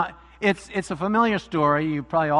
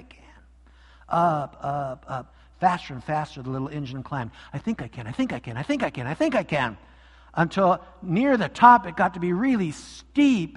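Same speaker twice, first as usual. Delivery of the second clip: story reading; 3 semitones lower than usual. A narrator's reading. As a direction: read it like story hour for kids. Pastor reads story aloud.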